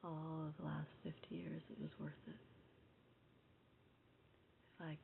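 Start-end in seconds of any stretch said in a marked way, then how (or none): 4.8-5.0 soft whispered words of thanks; whispering. Real, not acted. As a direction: whisper it gently to viewers. Tender sincere thanks to viewers.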